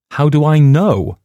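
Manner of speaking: high, loud indignant retort. A narrator's reading. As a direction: fire it back, offended. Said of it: "I replied indignantly".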